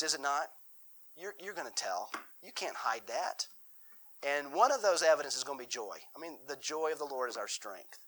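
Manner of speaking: ordinary talk, nothing marked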